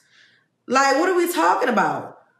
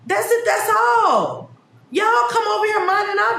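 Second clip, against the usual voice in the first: exasperated shout; 7 semitones higher than usual. Real, not acted. Exasperated outburst.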